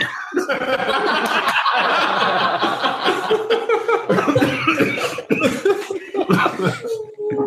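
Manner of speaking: drawn-out high-pitched villain laugh; gleeful evil cackle; long high cackle. Acted. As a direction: cackle long at your own prank.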